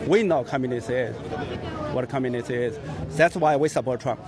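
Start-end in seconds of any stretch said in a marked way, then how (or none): none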